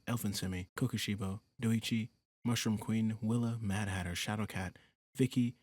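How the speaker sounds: normal talking voice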